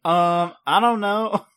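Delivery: put-on high, spacey California Valley Girl accent. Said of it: "it's literally just a Valley Girl accent".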